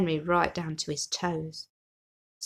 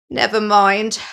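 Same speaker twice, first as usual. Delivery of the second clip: loud, pained groan of words; a sore-tummy moan; groaning with tummy ache; 8 dB louder, slower than usual. Acted. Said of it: "groaned Henry".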